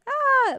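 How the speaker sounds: sudden high, loud "ah"; real surprised little scream; startled yelp. Real, not acted. Startled by a follow alert.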